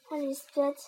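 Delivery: ordinary delivery, nothing marked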